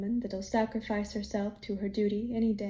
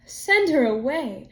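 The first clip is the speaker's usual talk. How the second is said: loud, slow, horrified; shocked exclamation; 3 semitones higher, 6 dB louder, slower than usual. Acted. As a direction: recoil at the very idea. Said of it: "exclaimed the farmer with a shiver".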